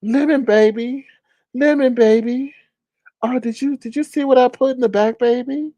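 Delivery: lightly voiced sweet-talking flirt; Just Chris impression. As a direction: coo it like a flirt. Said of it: "Just Chris is like".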